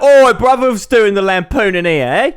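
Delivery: put-on jokey British accent with a laugh; mock British accent. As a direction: play a cheeky British bloke, overdone. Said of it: "I was gonna use my British accent".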